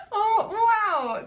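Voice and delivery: high giggle into a loud laughing wow; real giddy laughter; delighted laugh. Real, not acted. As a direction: laugh with sheer delight at the find.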